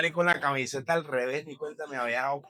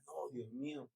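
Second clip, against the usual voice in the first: hushed plea; 2 semitones lower, 14 dB quieter than usual. Retold or imitated. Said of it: "y yo decía".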